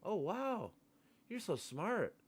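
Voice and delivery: high, slow, mock-impressed gushing; sarcastic fake amazement. Mocking a guy's Democrats-KKK point, high sarcastic gushing. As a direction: play dumb to mock him.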